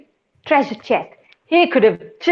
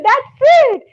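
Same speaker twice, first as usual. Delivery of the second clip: excited; 13 semitones higher, 6 dB louder than usual. Acted. Anansi cheerful reply.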